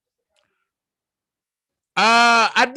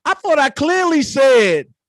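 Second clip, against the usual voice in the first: loud, raised-pitch indignation; exasperated insistence; 7 semitones higher, 9 dB louder than usual. Real, not acted. Host correcting people spreading assumptions, loudly exasperated. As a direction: push it out loud and high, setting them straight.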